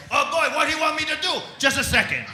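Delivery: high, loud French-Canadian caricature; exasperated mock politician calling out; flustered prime minister impression. Prime minister reacts to visitor.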